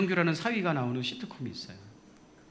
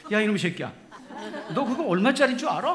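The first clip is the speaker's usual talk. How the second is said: higher, louder mock rant; angry father-in-law; 6 semitones higher, 5 dB louder than usual. Father-in-law yelling at son-in-law over the vase, mock fury. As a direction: scold the clumsy son-in-law, hammed up. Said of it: "하고 또 화를 내는 거야".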